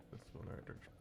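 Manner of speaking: whispered aside. Real, not acted. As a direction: whisper it to yourself. Gaming, muttering to self.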